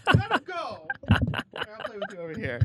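high squeaky pet talk, scolding; talking to the dog. Real, not acted.

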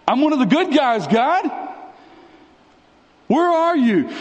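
high-pitched protest to God; acted pleading complaint. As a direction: plead indignantly, pitch riding up. Preacher acts his complaint to God.

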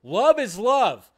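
sending up the everyone's-welcome crowd; mock love-is-love crowd. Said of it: "in a society that we are all just like".

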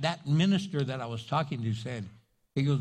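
normal talking voice

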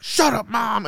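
high-pitched mock yell at mom. Paul yelling at his mom, mock yell. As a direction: play an exasperated son snapping. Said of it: "he's, like, yelling at his mom".

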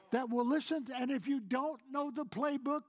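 ordinary talk

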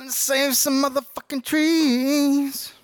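high, slow, drawn-out mock cry; hammed-up falsetto. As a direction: stretch it out way up high. Exaggerated punchline about saving trees.

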